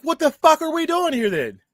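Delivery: retold exasperated outburst.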